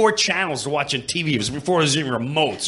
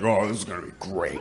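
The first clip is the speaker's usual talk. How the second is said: sarcastic; 9 semitones lower, 7 dB quieter, faster than usual. Retold or imitated. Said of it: "I'm like".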